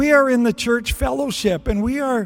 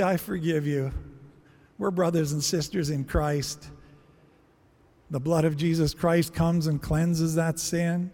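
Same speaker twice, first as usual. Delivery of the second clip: soft and gentle; 4 semitones lower, 6 dB quieter than usual. Acted. Forgiving brother, sermon gone soft.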